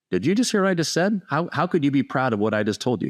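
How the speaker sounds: high-pitched incredulous question; retold disbelief. Player questioning the coach's pride in him, incredulous. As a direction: reenact being baffled, voice jumping up high.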